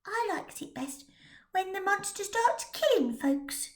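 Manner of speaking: put-on small girl hushed and eager; high breathy little girl; little match girl. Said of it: "said the little girl who sold matches".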